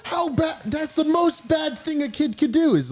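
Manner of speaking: high, slow mock-shouted disbelief; mock outrage. Retelling a childhood reaction to a cartoon, hammed-up high outrage. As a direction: ham up shocked outrage at a naughty kid. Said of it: "being like".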